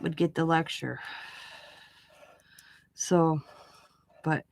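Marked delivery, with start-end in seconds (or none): none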